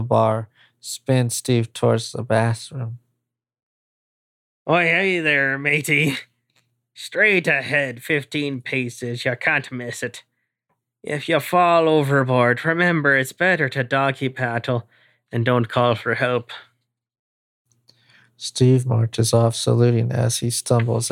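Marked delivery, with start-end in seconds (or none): 4.7-16.5 a hint of old sea dog; pirate voice. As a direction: talk like a salty sailor. Pirate-like bartender character.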